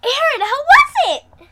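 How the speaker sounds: high, loud, laughing question; happy. Acted. Kaylee greeting her after the ride, high laughing question. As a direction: ask bubbling with glee. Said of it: "Kaylee asks happily".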